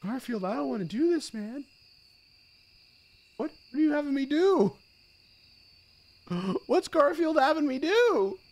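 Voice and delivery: high wail of dismay, rising in volume; distressed pleading. Real, not acted. Distressed at the game's order.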